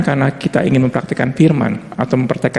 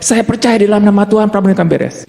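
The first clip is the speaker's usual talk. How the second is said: a louder, higher proclamation of faith; loud declaration; 6 semitones higher than usual. Shown as a demonstration. Preacher showing a loud faith declaration to the church, forceful. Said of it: "dengan kata-kata yang keras sekali".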